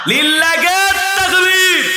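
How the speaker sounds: rally the crowd with a long call; calling out takbir. Real, not acted. Preacher calls crowd to takbir.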